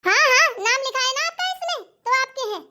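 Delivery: high-pitched mock agreement; sarcastic retort. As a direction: throw the claim back sarcastically. Sarcastic retort in momo quarrel.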